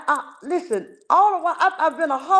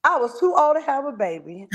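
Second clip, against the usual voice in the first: ordinary talk, nothing marked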